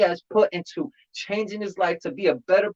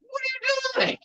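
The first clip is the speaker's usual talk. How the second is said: high mock whine; 6 dB quieter than usual. Scolding his own crying.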